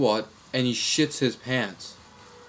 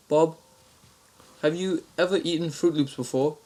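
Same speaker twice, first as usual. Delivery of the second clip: higher-pitched Aussie prank caller; mock-Australian voice, pitched up; Australian accent; 5 semitones higher than usual. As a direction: ask in a put-on Australian accent. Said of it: "same Australian accent".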